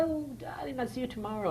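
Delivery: high, breathy-free Southern belle voice, lightly done; imitating a Southern belle, airy and high; Vivien Leigh Southern accent. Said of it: "she was very much Vivien Leigh".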